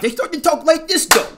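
high, loud put-on gangster voice; mock tough guy talk. Mocking girls who copy rough rap talk, gangster voice. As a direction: ham up a rough street voice. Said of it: "imitate guys".

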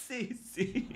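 high, very soft mimicry; mimicked meek agreement. Streamer mocking the other housemate, high and hushed mimicry. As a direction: imitate her tiny, high-pitched yeses. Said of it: "Y la otra".

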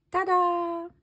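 loud, high showman reveal; proud ta-da. Acted. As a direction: present it with a flourish. Norman reveals his sled.

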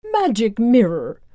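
a lightly voiced vain queen; evil queen voice. A narrator's reading. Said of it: "the evil queen asked the magic mirror".

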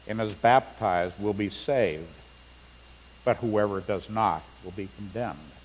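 ordinary talk

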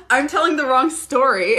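high bursts of laughter through the words; laughing at herself. Real, not acted. Realising she started the wrong story, laughing. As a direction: laugh off the mix-up. Catches herself mixing stories.

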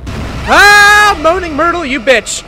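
high scream into loud cursing; scream, then curse at the top of your voice; startled scream. Real, not acted. Player scared by ghost, real scream and shouted curse.

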